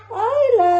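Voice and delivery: high, loud, stretched-out name; loud lilting mommy call; sing-song calling. Real, not acted. Mother calls to sleeping baby.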